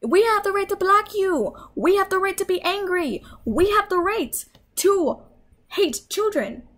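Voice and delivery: high whiny mock of kids claiming their rights; mocking bratty kids. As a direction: parody the brats with a sneer.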